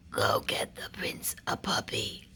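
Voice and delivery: whispered advice from a wise man. Acted. Wise man advising the king and queen, whispered. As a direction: breathe it like a secret. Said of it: "said the royal wise man".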